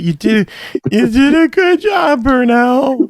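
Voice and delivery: soaring high, drawn-out praise; high sing-song praise. Pretend. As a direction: gush it like praising a child. Exaggerated praise after being teased.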